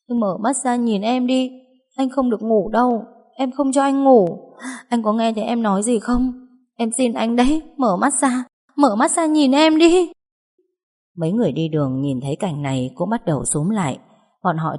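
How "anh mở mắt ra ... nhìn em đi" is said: high-pitched, pleading cry to wake him; crying while calling. A narrator's reading. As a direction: beg him tearfully, rising at the end. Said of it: "Tôi vừa khóc vừa gọi". (vừa khóc vừa gọi, van xin)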